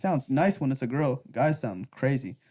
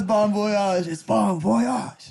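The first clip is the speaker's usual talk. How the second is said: mock French; 4 semitones higher, much slower than usual. Imitating Bon Voyage's accent.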